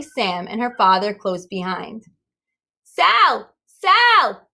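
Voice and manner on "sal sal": high, loud shouts; shouting. A narrator's reading. Wanda Lynn shouting for Sal at the Fix-It shop. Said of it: "shouted Wanda Lynn".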